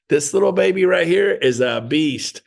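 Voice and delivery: usual speaking voice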